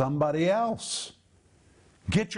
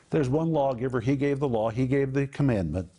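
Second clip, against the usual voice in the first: normal talking voice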